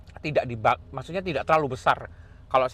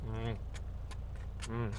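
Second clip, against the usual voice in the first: ordinary talk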